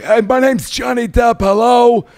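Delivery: high, slow, shouted mock star greeting; over-the-top Johnny Depp. Shown as a demonstration. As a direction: belt it out like a hammy celebrity hello. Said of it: "that's more like".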